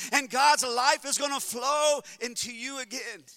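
loud, high, ringing preacher's cry; full-force preaching. Acted. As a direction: preach it out over the crowd.

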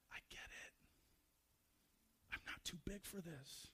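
very soft, intimate reassurance; hushed, consoling near-whisper; tender whisper. Acted. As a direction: lean in and barely voice it. God leans in to comfort.

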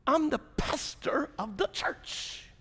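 slow, high, self-important complaint; mock indignant protest. Pastor protesting wife's request to congregation, slow mock indignation. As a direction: draw it out as a wronged pastor.

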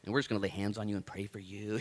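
normal talking voice

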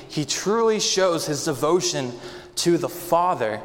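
ordinary delivery

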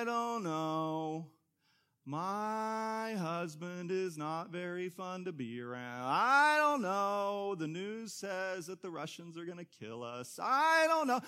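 slow, drawn-out gloomy whine; Eeyore-style moping pessimist; Eeyore mope. Retold or imitated. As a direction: stretch every I don't know like Eeyore. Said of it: "You remember Eeyore?".

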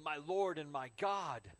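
loud, slow proclamation; awed exclamation. Acted. Thomas answering the risen Jesus, loud awed exclamation. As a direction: cry it out in conviction.

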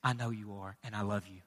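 very soft, very low voice; hushed, loving comfort; tender reassurance. Acted. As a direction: speak gently, as a father down at a child's level. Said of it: "God gets down on my level and He says".

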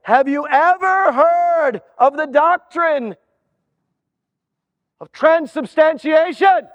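slow build to a shouted long word; pompous examiner. Acted. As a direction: lay the big doctrine on him. Manager questions the man.

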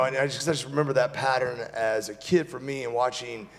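usual speaking voice

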